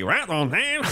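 high twangy sung line; twang. Pretend. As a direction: pinch it into a twang. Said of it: "you do the twangy voice".

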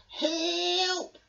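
high, drawn-out scared cry; cling on and call out; yelling for help. Acted. Mole stuck in a tree yells for help. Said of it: "he yelled".

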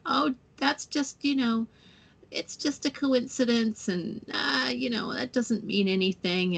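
lightly hammed-up voice of the sceptical mind, brushing it off; stupid inner voice. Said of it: "that stupid voice will speak up".

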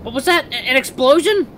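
high, loud panicked exclamations; startled shouts building to a drawn-out yell; alarmed cry. Acted. Alarmed cries during an explosion.

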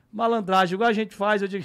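ordinary talk, nothing marked

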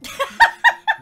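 very high, loud shrieking laugh. Real, not acted. Streamer laughs as Carson ages up, shrieking laugh. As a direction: burst into a shrill laugh.

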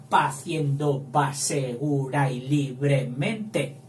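"Paciendo va segura y libremente" slowed to count syllables; slow verse counting; slow scansion. Shown as a demonstration. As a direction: read it slowly to count. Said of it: "Sin alefa también".

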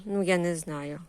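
usual speaking voice

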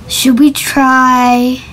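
usual speaking voice

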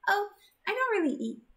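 put-on shy gentle girl. Zenith voiced high.

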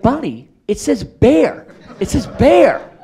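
high, loud, drawn-out mock complaint; exasperated protest. Director objecting to his casting woman, exasperated mock outcry. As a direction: hammer it home in comic disbelief.

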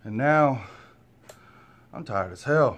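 ordinary talk

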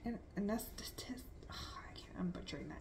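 embarrassed whispered correction; whispered aside. Real, not acted. Tripping over the word anesthetist, whispering to herself. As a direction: whisper it to yourself.

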